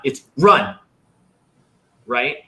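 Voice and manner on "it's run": short firm "run" with a falling tune; decisive command. Shown as a demonstration. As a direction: settle it, no doubt. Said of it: "can't wonder whether you're gonna run".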